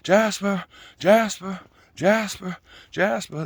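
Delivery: mock yelling. Man calling his runaway dog's name, mock yell. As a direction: imitate an owner calling his dog over and over. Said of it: "he's yelling at the dog".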